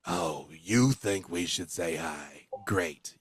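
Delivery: low, slow mocking drawl; sarcastic gruff voice. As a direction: sneer at a timid suggestion. Gruff voice mocks the timid one.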